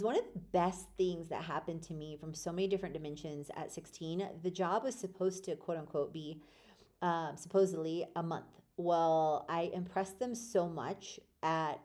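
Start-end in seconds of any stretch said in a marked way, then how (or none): none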